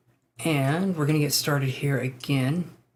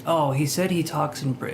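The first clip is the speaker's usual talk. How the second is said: usual speaking voice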